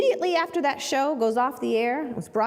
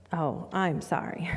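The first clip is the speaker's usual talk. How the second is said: soft, slow, lowered apology; quiet apology; 7 semitones lower, 7 dB quieter, slower than usual. Retold or imitated. Lawyer softly turning away a client. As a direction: let him down gently and softly.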